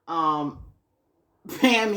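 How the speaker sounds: normal talking voice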